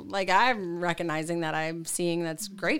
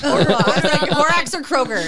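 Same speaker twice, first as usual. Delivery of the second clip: laughing; 7 semitones higher, 11 dB louder than usual. Retold or imitated. Retelling her grocery rant, laughing.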